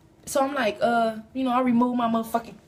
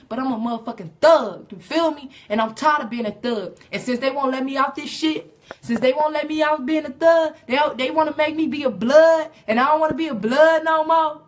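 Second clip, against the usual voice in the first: louder and higher, building mock distress; mock anguished rant; 4 semitones higher, 6 dB louder than usual. Comic telling the audience he is tired of being a blood, mock anguished. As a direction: wail it like a fed-up gangster.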